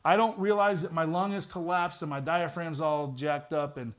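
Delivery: ordinary talk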